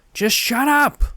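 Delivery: high, loud yell; crying out. A narrator's reading. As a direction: snap it out at full volume. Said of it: "Andy cried out to him".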